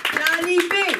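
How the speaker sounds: big announcer call-out. Real, not acted. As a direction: call the name out like a host. Host announcing the comedian.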